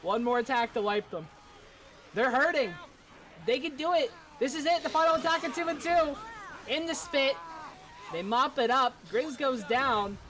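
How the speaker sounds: tense casting rising in pitch; excited play-by-play. Real, not acted. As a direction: call the final attack with mounting excitement. Live play-by-play of game.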